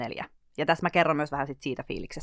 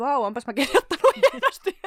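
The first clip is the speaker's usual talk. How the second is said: laughing self-praise; 9 semitones higher, 6 dB louder than usual. Retold or imitated. Mock praising her own writing.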